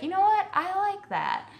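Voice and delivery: high-pitched delighted realization; mock pleasant surprise, high and bright-eyed; pleased surprise. Imagined pleased reaction after tasting.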